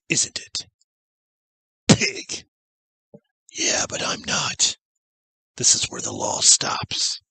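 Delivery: mostly whispered, cold threats; quiet menacing quote. Retold or imitated. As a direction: breathe the lines out low and deadly. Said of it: "When you can quote lines from 'em".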